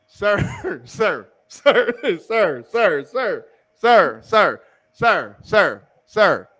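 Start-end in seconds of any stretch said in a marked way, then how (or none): none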